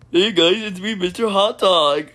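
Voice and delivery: put-on cartoon greeting; Mister Hot Dog. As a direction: announce yourself like an eager mascot. Mister Hot Dog introduces himself.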